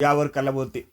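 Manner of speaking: ordinary delivery, nothing marked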